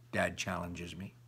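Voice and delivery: ordinary delivery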